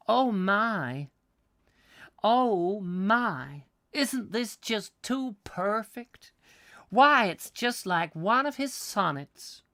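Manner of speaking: an American tourist swooning over the scenery; gushing American. Retold or imitated. Tourist quoting an imagined American, high gushing American accent. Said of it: "This is obviously an American".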